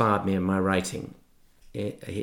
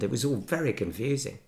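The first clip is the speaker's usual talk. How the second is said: ordinary delivery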